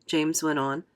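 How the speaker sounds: ordinary delivery, nothing marked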